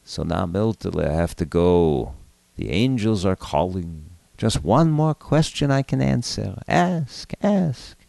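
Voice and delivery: put-on thin old grandfather, drifting away; Zadie's spirit voice. A narrator's reading. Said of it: "the voice of his Zadie coming from the medium".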